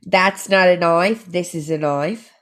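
put-on Aussie film-quote accent; "knife" broadened in an Australian accent. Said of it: "an Australian accent to share".

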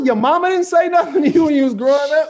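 high, loud, incredulous telling-off; mock scolding. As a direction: scold them loudly about their upbringing.

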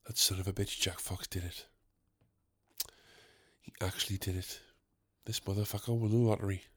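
very low, soft murmur; hushed mock awe. Fan realising the host won, hushed mock awe. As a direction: murmur it to yourself, barely audible.

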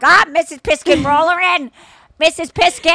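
high, loud fawning welcome; vet staff welcome. Retold or imitated. Vet staff greeting a valued client, fawning. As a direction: gush over the rich client. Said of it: "They're like".

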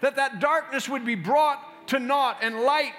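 loud, high, forceful intercession; fervent prayer. Acted. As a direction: pray with full authority over the congregation. Opening prayer at full force.

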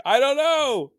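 high, loud helpless wail; exasperated helplessness. Retold or imitated. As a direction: throw it back at the kid, totally lost. Acts helpless dad answering son.

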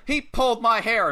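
high-pitched tattletale whine to the ref; mock complaint. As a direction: imitate tattling on someone. Retold complaint to the referee.

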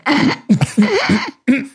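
a stifled laugh bursting out; like air escaping a balloon; snorting burst of laughter. Retold or imitated. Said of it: "Like a balloon about to lose all its air".

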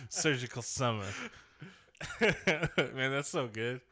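normal talking voice

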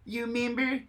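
high, breathy little whisper; squeaky member berries voice. Acted. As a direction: coax like a tiny talking berry. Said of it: "my attempt at member berries".

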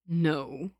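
a curt, sneering no; dismissive sneer. A narrator's reading. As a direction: cut him off with scorn. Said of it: "Lin Qingyu sneered".

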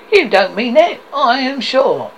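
high, loud, eager objection; protesting nephew. Acted. Nephew doubts his uncle means it, lively protest. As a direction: object brightly, pitched well up.

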